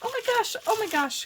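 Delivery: thrilled squeal. Real, not acted. Crafter discovering falling leaves, squealing with delight. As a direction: squeal like you just found treasure.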